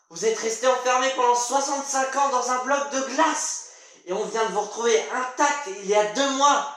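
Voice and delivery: exasperated stage character lecturing with bursts of command; brusque revival doctor. Acted. Doctor to man thawed after sixty-five years, brusque and exasperated.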